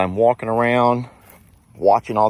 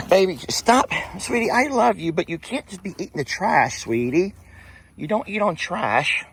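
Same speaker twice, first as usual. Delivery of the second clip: much higher sing-song scold of the pet; sweet high voice scolding a dog in the trash; 11 semitones higher than usual. Real, not acted. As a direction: coo at the animal while telling it off.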